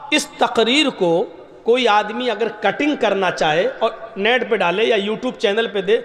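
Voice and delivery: usual speaking voice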